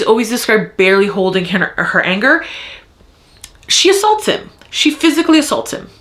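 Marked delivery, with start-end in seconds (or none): 3.7-5.9 high outraged stress on assaults. Real, not acted. Reader outraged at the cop character, high and indignant. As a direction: hit assaults in high disbelief. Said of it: "I'm so mad right now".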